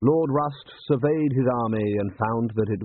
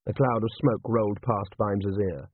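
plain reading voice

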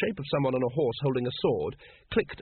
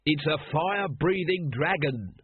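understated scream; 4 semitones higher, slower than usual. A narrator's reading. Vimes warns the crowd of the dragon, mock scream. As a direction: stretch it out in mild outrage. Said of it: "screamed Vimes".

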